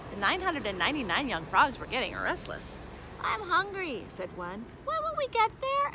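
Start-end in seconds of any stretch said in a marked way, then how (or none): 3.2-4.1 high little hungry whine; complaining young frog. A narrator's reading. 4.8-5.9 put-on very high child's complaint; impatient young frog. A narrator's reading.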